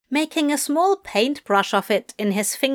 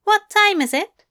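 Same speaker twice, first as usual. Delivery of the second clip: higher, fussy angelic voice; Aziraphale voice; 11 semitones higher, 4 dB louder than usual. A narrator's reading.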